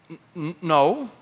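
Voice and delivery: hesitant stammer; tentative, stuttered reply; caught off guard. Retold or imitated. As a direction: stumble softly into a small refusal. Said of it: "I was taken completely off guard".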